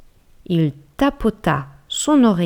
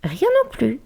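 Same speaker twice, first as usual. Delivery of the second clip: mouse voice; 9 semitones higher than usual. Acted. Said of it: "déclara-t-elle".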